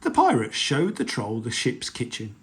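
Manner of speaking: ordinary delivery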